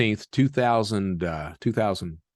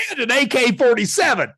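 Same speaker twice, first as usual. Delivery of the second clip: called out; 11 semitones higher, 4 dB louder than usual. Retold or imitated. Calling after agent to bedroom.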